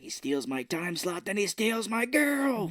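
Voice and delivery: slow, climbing mock complaint; aggrieved whine. Retells character's bitter complaint.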